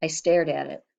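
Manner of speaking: usual speaking voice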